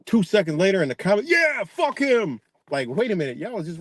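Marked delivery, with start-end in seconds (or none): 1.3-2.4 high, drawn-out jeering yell; mock mob cheer. Vlogger mimicking brainwashed commenters turning on somebody, mock rowdy yell. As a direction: imitate a follower eagerly piling on. Acts out fickle followers' comment.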